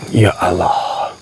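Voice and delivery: slow, drawn-out invocation; awed exclamation. Real, not acted. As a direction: stretch it out in shock. Awed reaction at tree. (menyebut Allah dengan panjang)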